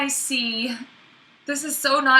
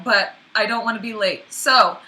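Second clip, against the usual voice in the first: normal talking voice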